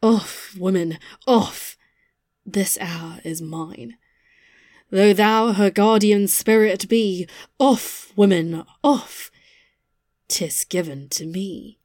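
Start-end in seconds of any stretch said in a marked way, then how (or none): 2.4-4.0 slow, soft eerie whisper; hollow whisper. Acted. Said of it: "with hollow voice cries she". 10.3-11.8 hollow whisper. Acted. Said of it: "with hollow voice cries she".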